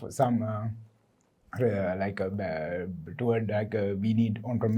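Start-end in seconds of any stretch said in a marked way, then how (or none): none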